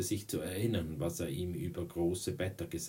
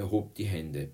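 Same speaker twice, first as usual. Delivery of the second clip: plain reading voice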